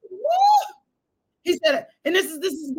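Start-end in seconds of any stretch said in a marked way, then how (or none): 0.1-0.7 loud high woo; preacher whooping in praise; joyful whoop. Real, not acted. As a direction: let out a loud celebratory cry. Preacher's exultant shout.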